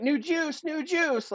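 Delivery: high-pitched, repeated silly call; mock flustered blurt. Caught by coworker at work, high flustered blurt.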